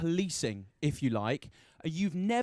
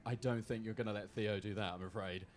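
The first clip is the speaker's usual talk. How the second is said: low, soft word of caution from the back; a hint of an apologetic official, pitched down and hushed; lightly voiced insurance bloke; 9 semitones lower, 12 dB quieter than usual. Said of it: "an insurance bloke at the back of the room going".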